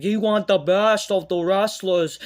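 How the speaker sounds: loud, high, slow mock boast; hammed-up, drawn-out taunt; mocking impression. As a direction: bellow it slowly as a parody promo. Mocking the tag team's promo.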